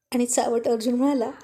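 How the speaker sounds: usual speaking voice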